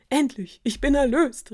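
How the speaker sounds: high, loud elated prince; enthusiastic. A narrator's reading. Prince freed from his curse, calling out enthusiastically. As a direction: exclaim it with joyful relief. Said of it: "rief der Prinz begeistert".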